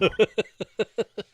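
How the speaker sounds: loud, high laugh. Real, not acted. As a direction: burst out laughing. Laughing at the story.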